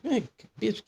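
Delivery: mock-exasperated high call; high-pitched demand. Adam demands the apple from Eve, pitched high. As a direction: jump far up in pitch.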